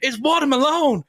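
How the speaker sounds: high, loud mimicked exclamation; over-the-top high catchphrase voice; vine guy impression. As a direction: imitate the meme guy, pitched right up. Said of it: "a guy goes".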